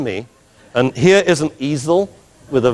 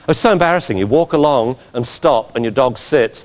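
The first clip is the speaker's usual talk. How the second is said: normal talking voice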